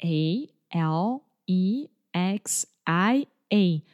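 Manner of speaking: letter by letter, slow and deliberate; spelling slowly. Retold or imitated. Spelling her name slowly to the confused neighbor. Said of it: "And I was like".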